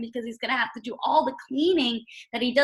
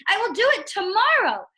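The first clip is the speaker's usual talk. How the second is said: higher, louder, fed-up cry; 7 semitones higher, 5 dB louder than usual. Retold or imitated. Teacher rereading Toad's cry, louder. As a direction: shout it in frustration. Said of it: "cried Toad".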